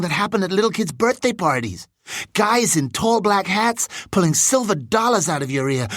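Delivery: put-on high, lively voice of an eager boy; excited new boy.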